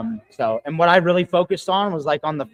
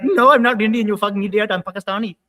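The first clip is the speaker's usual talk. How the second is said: louder, higher mock Indian accent; retold Indian accent; 6 semitones higher, 7 dB louder than usual. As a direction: fire back in a hammed-up Indian accent. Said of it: "answered in an Indian voice".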